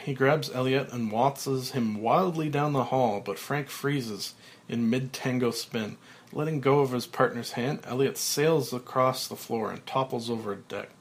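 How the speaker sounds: usual speaking voice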